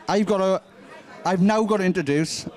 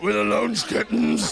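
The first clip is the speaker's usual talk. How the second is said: deep bass voice. Pretend. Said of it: "say something really horrible in a really deep voice".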